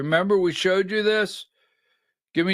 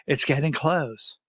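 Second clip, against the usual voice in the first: lower, hushed mock teasing of an official promise; a lightly put-on voice of an attorney general dangling news, pitched down; Pam Bondi; 5 semitones lower, 4 dB quieter than usual. Host mocks attorney general's teasing TV promise, lower hushed mock voice. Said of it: "Pam Bondi is coming out ... on Fox News".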